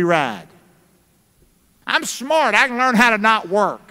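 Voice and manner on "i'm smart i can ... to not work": mock lazy man.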